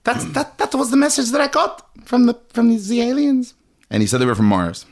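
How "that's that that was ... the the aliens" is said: mock Tesla. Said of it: "He was like".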